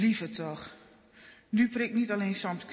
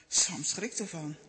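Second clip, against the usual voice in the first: ordinary delivery, nothing marked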